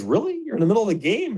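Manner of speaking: pitched well up in disbelief; a hot dog vendor who can't believe a player is ordering mid-game; incredulous vendor. Retold or imitated. Vendor asks the player, in disbelief, high and startled. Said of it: "The guy goes".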